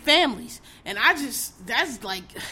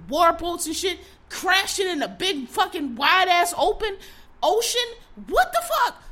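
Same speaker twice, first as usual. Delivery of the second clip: outraged shout; 7 semitones higher, 4 dB louder than usual. Real, not acted. Ranting to listeners about Navy crashes, real angry shout. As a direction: voice climbing into a high, loud yell of disbelief.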